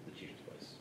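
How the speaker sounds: unvoiced whisper to self. Real, not acted. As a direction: breathe it out in a whisper. Repeating information to self.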